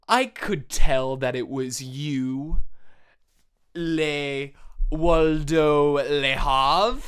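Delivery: slow, loud, drawn-out unmasking line; hammy slow loud accusation; dramatic accusation. Acted. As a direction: drag out the name like a melodrama reveal. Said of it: "And he goes".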